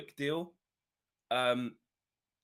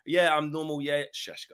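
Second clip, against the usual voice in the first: mocking Owen; 3 semitones higher, 5 dB louder than usual. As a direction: mimic his plain chat then drop into the accent. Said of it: "you're doing the casual, normal, normal talk".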